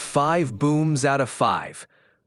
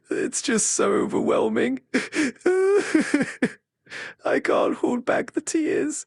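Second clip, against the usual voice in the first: fake crying, more intense; 9 semitones higher than usual. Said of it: "a bit more intensely?".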